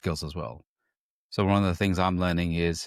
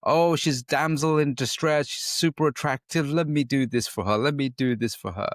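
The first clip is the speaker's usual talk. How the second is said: lightly acted rescuer, pitched higher; higher-pitched mock urge to save her; eager fixer; 6 semitones higher than usual. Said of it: "their fixer comes in".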